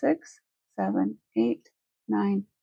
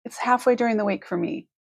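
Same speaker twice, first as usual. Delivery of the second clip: ordinary talk, nothing marked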